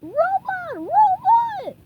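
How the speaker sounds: very high, loud panicked scream. A narrator's reading. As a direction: shriek it running for life. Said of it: "SpongeBob screaming".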